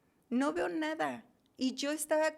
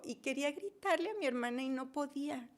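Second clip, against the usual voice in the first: normal talking voice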